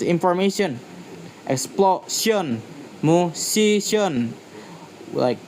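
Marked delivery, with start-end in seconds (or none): 1.5-2.6 "Explosion" drawn out, stressed syllable before -sion; stress before the ending. Shown as a demonstration. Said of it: "stress falls on syllable, syllable right before that ending". 3.0-4.3 "musician" slowly, stress on "si"; stress before the ending. Shown as a demonstration. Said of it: "stress falls on syllable, syllable right before that ending".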